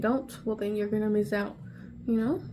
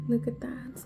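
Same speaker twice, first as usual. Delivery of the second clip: ordinary talk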